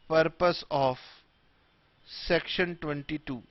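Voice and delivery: slow, isolated words with long gaps; word-by-word dictation. Shown as a demonstration. Teacher dictating a tax definition to students, word by word. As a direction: dictate slowly for note-taking.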